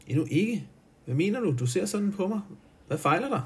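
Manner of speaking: an anxious woman, pitching up; fru Engelhardt's voice. Acted. (høj, urolig kvindestemme)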